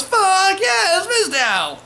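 very high, loud cheer; cheering crowd. Retold or imitated. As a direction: scream it like a crowd going wild. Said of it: "And the crowd goes".

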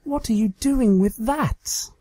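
high, mock-shocked pony voice; Trixie, mock alarmed. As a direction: ham up the discovery. Trixie playing pretend.

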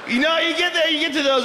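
laughing high repeat. Real, not acted. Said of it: "repeats higher, laughing".

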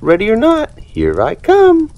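high, sing-song seeker's call; Dory calling out. Acted. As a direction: call it out playfully like a kid seeking. Dory calling during hide and seek.

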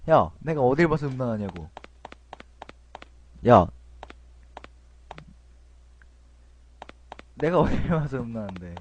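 loud, offended objection; indignant protest. Real, not acted. Gamer offended by the game calling him lewd, loud indignant protest. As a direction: protest loudly that it's unfair.

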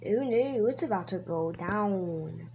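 ordinary talk

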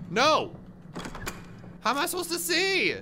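very high, alarmed whine; high-pitched frantic complaint; panicked protest. Real, not acted. Lights go out in game.

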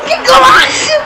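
high thrilled cry; excited shout. Real, not acted. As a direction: cheer the goal, way up high. Goal celebration in game. (grido esultante)

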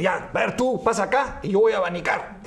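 snatch the fan, fed up; exasperated husband takes over; desperate order. Acted.

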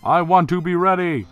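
high, loud, bombastic declaration; loud brother character. Acted. Skeleton declaring he must be ready, loud bombast. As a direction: proclaim it high at full volume. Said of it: "that's how he talks, definitely".

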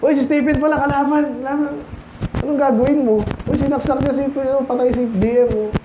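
high, frantic voice of someone caught in chaos; panicked soldier in battle. Pretend. Preacher acting out soldiers at war, high and frantic. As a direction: cry out like a fighter in the middle of a war. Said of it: "Kunwari dito".

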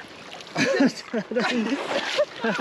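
high, bright burst of laughter; relieved laugh. Real, not acted. After netting the fish for her friend, happy laughter. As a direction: laugh out the tension of the catch.

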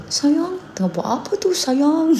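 high call up the stairs. Retold or imitated. Son calling down to breakfast, high shout. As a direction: sing out high to the wife. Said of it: "Dia jerit".

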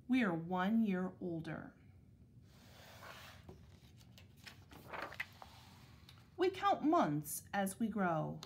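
measured picture-book reading with clear pauses; unhurried, carefully articulated storybook narration; read-aloud to children. Acted. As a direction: read it to second graders, phrase by phrase. Reads picture book to second graders.

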